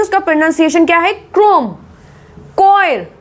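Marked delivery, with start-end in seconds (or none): 1.3-1.7 क्रोम with a hard k at the start; higher-pitched model of क्रोम, h silent; model pronunciation. Shown as a demonstration. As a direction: say क्रोम as k-rom. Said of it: "बोलने की आवाज बता रही हूँ".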